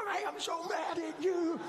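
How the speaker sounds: high mock-furious shout at the kids left behind; father shouting his anger at the kids through train doors; mad at the kids. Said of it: "I'm looking at them like".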